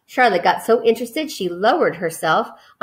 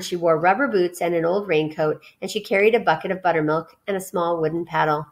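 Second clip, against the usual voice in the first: ordinary delivery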